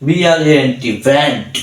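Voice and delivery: letters of brand run together without breaks; one connected spell-out of brand. Shown as a demonstration. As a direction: spell straight through, then say brand whole. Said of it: "আমরা একভাবে পড়তে পারতাম".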